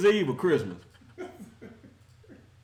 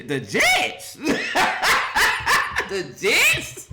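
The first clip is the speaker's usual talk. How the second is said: laughing; 8 semitones higher, much slower than usual. Real, not acted. Laughing at the Jets loss.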